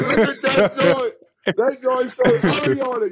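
high, slow, awed look at that; high-pitched slow amazement; amazed inner voice. Retold or imitated. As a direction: gawk at it in slow wonder. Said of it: "I'm like".

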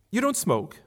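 a touch of shocked disbelief; young woman lightly scandalised; Marion. Acted. Marion appalled.

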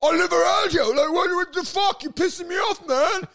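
high, loud, indignant shouting; mock furious passer-by yelling back; offended outburst. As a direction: blow up at the insult. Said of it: "They're like".

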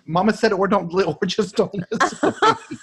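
high-pitched imitation of an innocent child; literal-minded child. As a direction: parrot mom's words as a kid missing the sarcasm. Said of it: "Not getting the sarcasm yet".

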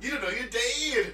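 high, loud mock declaration; hammed-up mimicry. Co-host exaggerates the preacher's line, loud mock delivery. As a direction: blow the creepy line up loudly.

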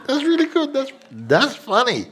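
hammed-up delight at a joke. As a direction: play the amused listener, tickled. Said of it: "you might be just saying".

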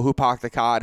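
broad Boston accent with dropped R's. Shown as a demonstration. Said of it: "I grew up in Boston".

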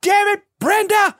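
high, loud, slow furious shout; angry shout. Retold or imitated. Casting director storming in, shouting about her lunch. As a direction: yell through the door at a coworker. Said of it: "She goes".

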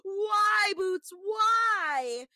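high, loud mock guffaw into a pleading why; exaggerated high-pitched laughing wail; imitated full-on laughing. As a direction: imitate him cracking up as he cries out. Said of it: "full on laughing".

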